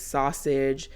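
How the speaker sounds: ordinary talk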